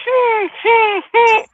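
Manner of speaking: high imitated puppy whine. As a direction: whine like an alarm-clock puppy. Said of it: "Bitch be like".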